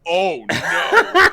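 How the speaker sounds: loud, high "oh no" breaking into laughter; hammed-up horror at being found out; mock dread. Man caught as the girl turns around, mock dread.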